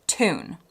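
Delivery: tune said TOON, no y sound; American pronunciation. Shown as a demonstration. Teacher giving the word in own accent, American TOON for tune. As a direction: drop the y in tune, a plain oo. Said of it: "in my accent".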